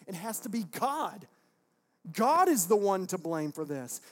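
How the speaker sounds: loud, forceful acted accusation; accusing shout. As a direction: play an outraged people laying blame, loudly. Acting out Germans blaming God in play.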